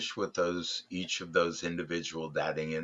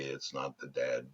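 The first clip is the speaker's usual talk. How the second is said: ordinary talk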